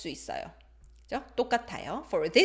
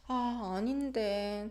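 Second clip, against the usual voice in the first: soft, hesitant 아닌데 dropping at the end; falling, hesitant intonation; 5 dB quieter, faster than usual. Shown as a demonstration. Teacher showing disagreement without a fight, falling hesitant intonation. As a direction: let 아닌데 sink gently, non-confrontational. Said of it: "it shows hesitation and it's non-confrontational".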